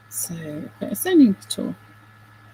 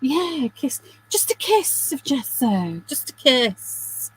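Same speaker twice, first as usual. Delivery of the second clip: playful Jamie Oliver echo; 5 semitones higher than usual. Pretend. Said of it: "repeating Jamie Oliver phrase".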